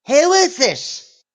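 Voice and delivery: high, loud, drawn-out mock demand; mock indignant yell. Mother demands the prank caller's name, mock indignant yell. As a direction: imitate a mom demanding it loudly. Mom retold, baffled on prank call.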